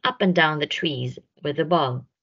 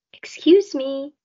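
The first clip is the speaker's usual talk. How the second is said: timid; 9 semitones higher, 3 dB louder than usual. A narrator's reading. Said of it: "said Alice timidly".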